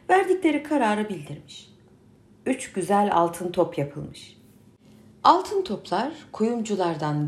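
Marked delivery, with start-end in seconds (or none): none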